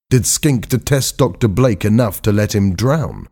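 ordinary delivery

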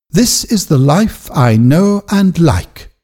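calmly certain, a bit slow; firmly. A narrator's reading. Eppie answering Silas, firm and slowed. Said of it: "Eppie said firmly".

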